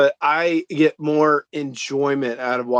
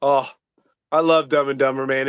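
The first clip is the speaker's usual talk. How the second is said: usual speaking voice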